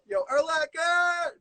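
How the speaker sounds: mock call across the bar. Friend pretends to hail the linebacker-sized woman with a slow yell. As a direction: holler it like hailing someone across a room.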